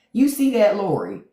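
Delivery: you see that, pitched high; incredulous. Real, not acted. Calling out to Lori in disbelief.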